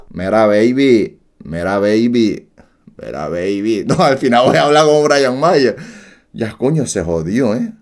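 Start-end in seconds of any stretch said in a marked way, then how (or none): 0.1-3.8 deeper voice. Shown as a demonstration. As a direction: practise a deep seducer's line. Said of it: "la voz tiene que ser más grave".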